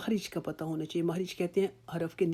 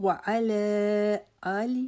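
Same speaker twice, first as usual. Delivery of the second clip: high, very slow chant of Muhammad; tajweed recitation; 6 semitones higher, much slower than usual. Shown as a demonstration. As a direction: recite وعلی آل محمد, drawing every vowel out. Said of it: "اس کو لمبا ساتھ کرنا ہے".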